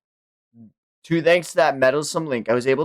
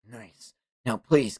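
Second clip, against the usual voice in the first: ordinary delivery, nothing marked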